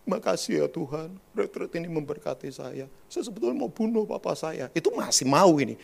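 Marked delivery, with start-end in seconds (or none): 0.1-4.7 imitated weepy testimony; put-on tearful. Exaggerated retreat testimony thanking God, mock tearful. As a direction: fake a crying, overdone thank-you. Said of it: "Kalau sambil nangis-nangis itu biasanya".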